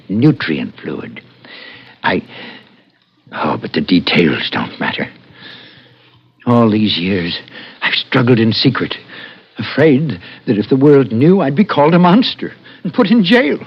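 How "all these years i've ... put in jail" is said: rising into panicked desperation; climbing from worry to near-hysteria as he fears jail; frantic fear. Acted. Professor frantic, fears exposure.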